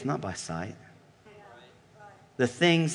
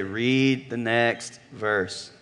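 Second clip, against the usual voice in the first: slow call and response; 5 semitones lower, 4 dB quieter, much slower than usual. Shown as a demonstration. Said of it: "Everybody say".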